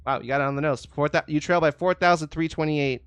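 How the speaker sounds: ordinary talk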